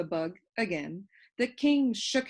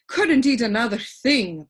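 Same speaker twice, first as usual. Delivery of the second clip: puffed; 3 semitones higher, 8 dB louder than usual. A narrator's reading. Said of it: "puffed the Duke".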